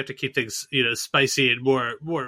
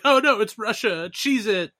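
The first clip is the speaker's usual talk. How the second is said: high, slow mock panic, hamming up a scared soldier; 6 semitones higher, slower than usual. Imagining Ukraine's troops, high mock panic. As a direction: squeal like a soldier about to flee.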